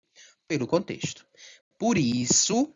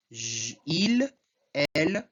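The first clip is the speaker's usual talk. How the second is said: ordinary talk, nothing marked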